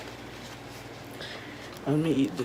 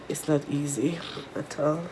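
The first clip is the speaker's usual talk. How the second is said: ordinary talk, nothing marked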